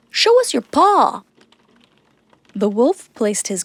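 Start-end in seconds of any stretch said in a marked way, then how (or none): 0.1-1.2 oldest goat. A narrator's reading. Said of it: "said the oldest goat".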